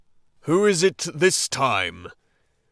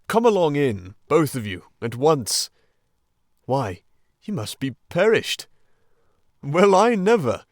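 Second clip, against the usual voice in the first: louder, welcoming old badger; kindly Badger; 3 semitones higher, 6 dB louder than usual. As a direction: fuss over them warmly. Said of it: "in quite a different voice".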